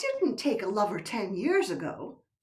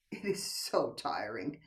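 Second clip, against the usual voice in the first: whisper; 12 semitones lower, 8 dB quieter than usual. Acted. Wife soothing husband.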